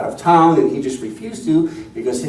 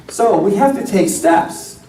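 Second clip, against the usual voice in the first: ordinary talk, nothing marked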